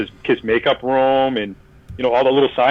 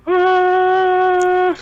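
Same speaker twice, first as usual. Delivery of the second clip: long, high sustained tone; awestruck "ah" sound; 3 dB louder than usual. Retold or imitated. Fan reaching the prime zone, high held "ah". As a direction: hold one high note like a revelation.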